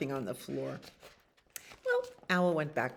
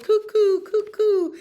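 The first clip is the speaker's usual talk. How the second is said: high, loud singsong cuckoo call; 10 dB louder than usual. A narrator's reading. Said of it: "The cuckoo called".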